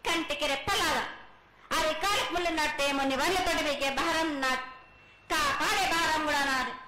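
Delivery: normal talking voice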